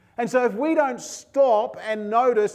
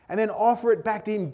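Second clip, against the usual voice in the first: ordinary talk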